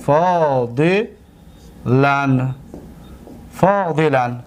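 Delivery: very slow, each word said twice with long pauses; slow dictation. Shown as a demonstration. Teacher dictating the example sentence slowly to students.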